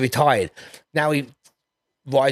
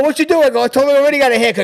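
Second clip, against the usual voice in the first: higher, louder retold back-and-forth; excited retelling; 11 semitones higher, 7 dB louder, much faster than usual. Son and father arguing about the stranger, high and loud. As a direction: rush through the dad and kid quotes.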